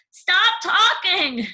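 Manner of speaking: slow, high, drawn-out plea; each word stretched in exasperation; exasperated stepmother. Acted. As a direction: stepmother begging Cindy to stop talking.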